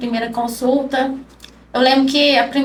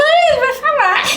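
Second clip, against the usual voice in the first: voz de criança bem aguda; menininho; 11 semitones higher, 4 dB louder than usual. Retold or imitated. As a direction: imite um garotinho exclamando para a mãe, tom lá em cima. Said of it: "o Davi sentou lá no chão". (criança consolando a mãe em voz aguda)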